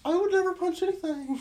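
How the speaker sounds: high, sweet little princess voice; sweet fairy tale princess. Retold or imitated. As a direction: do her in a tiny Disney princess voice. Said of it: "you turned her into Cindy Lou Who".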